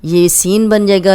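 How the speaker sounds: ordinary delivery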